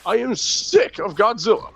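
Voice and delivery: put-on high, strained old movie dub voice; dub actor voice. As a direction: ham it up like a bad dub. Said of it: "It's the guy that's like".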